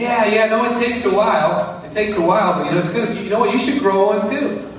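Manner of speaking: lightly voiced hopeful Tommy; eager Tommy. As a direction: reassure the neighbor brightly. Tommy voiced answering neighbor.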